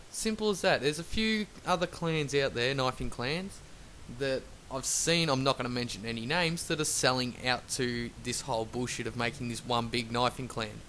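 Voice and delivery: usual speaking voice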